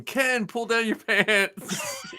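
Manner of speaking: high, drawn-out mock yell without the volume; stretched-out pretend holler; retold yell. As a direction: imitate calling out across a film set, slowly. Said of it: "and I'm yelling".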